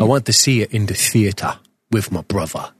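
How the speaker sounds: put-on Cockney accent; mock London accent. Podcaster recalls a cinema trip with his brother, in mock London accent. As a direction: repeat it as a joke Londoner. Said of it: "my Jason Statham sort of London thing".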